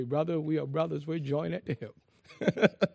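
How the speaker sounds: a quiet, slow imitation of Brother Harold; imitating Brother Harold. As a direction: drawl the line the way Harold did. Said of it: "Brother Harold used to say".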